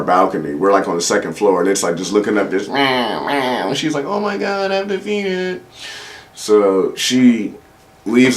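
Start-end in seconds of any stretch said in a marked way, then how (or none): 2.7-3.7 soft, drawn-out meowing; cat meow. Retold or imitated. Cat below the balcony looking up, soft meow. 4.0-5.6 very high, soft mimicking voice; frantic girlfriend impression. Girlfriend must feed the stray cat, high mock distress.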